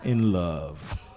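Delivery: deep bass croon, long drawn-out vowel; put-on low love-man voice.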